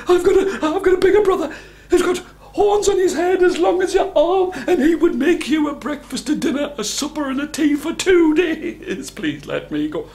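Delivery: very high, loud frantic squeal; shrieky pleading goat, settling at the end; panicking middle goat. A narrator's reading. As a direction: bargain desperately in a high goat voice.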